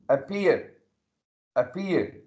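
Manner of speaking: "appear" repeated, peaking on the P syllable; stress on P. Shown as a demonstration. Teacher has students feel the stressed P in "appear". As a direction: hit the "pear" of "appear" from the P. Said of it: "the letter P is being stressed".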